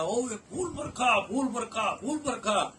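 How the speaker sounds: high, slow calling out, repeated; mock yell to the crowd; retold shouting. A man at the idol shouting about the flowers, high calling out. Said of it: "जोर जोर से चिल्ला रहा".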